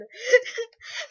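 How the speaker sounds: can't hold the giggle in; high helpless laughing fit; real laugh. Real, not acted. Reader cracks up at text.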